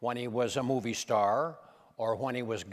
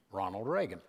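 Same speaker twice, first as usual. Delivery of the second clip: ordinary talk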